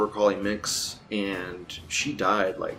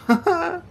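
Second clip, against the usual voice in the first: high laugh; 5 dB louder than usual. Real, not acted.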